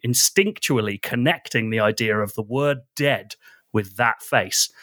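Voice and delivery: normal talking voice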